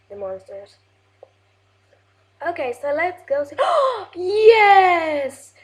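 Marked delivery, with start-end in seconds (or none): 3.6-4.1 sharp high gasp of delight; excited gasp. Real, not acted. Gamer spotting the third wheat, gasping with delight. 4.1-5.5 loud, high cheer of triumph; excited yes. Real, not acted. Gamer finally getting the third wheat, cheering loudly.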